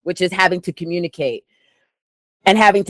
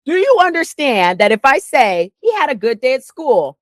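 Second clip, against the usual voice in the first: lecturing brother; 6 semitones higher than usual. Retold or imitated. Lecturing brother in retold argument.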